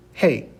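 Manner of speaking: hey for the letter G, breath h; Spanish letter name. Shown as a demonstration. Teacher shows how G sounds in the alphabet, clear demonstration. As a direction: say hey with a falling tone. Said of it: "which sounds like".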